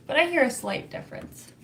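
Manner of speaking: ordinary talk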